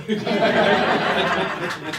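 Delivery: loud, bright burst of laughter; big real laugh at his own luck; delighted laugh. Real, not acted. Laughs after landing the role.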